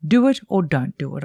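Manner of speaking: ordinary talk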